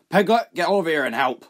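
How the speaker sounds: loud high shout across the dock; shouted call. Acted. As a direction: bark the name to summon the boy. Smuggler calls the boy.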